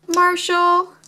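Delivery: high, excited naming. Acted. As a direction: call the name out brightly.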